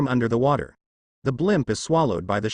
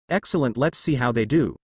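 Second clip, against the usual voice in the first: ordinary delivery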